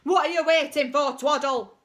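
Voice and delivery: loud, booming coach call; loud sports teacher shout; Bulk's voice. Shown as a demonstration. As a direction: call the team on like Bulk. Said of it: "Mister Bulk's voice".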